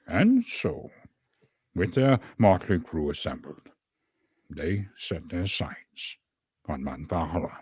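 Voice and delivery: halting, portentous wizard narration; Gandalf. Shown as a demonstration. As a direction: intone it like a wizard, pausing between phrases. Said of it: "my best attempt at Gandalf".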